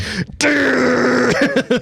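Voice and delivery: high, sustained needle buzz; imitated tattoo machine buzz. Guest showing how the tattoo felt, high buzzing noise. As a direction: imitate the needle droning as it digs in. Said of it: "it was like".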